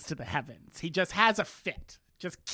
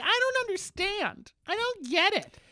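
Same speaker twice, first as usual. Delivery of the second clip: high, whiny, bewildered voice; mock high whine of a lost prince; whiny Charles. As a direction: pitch way up as the confused prince. Charles voiced confused in recap.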